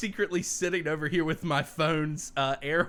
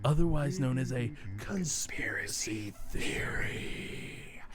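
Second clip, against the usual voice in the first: growly mystery voice; 4 semitones lower, slower than usual. Acted. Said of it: "I kinda went a little growly".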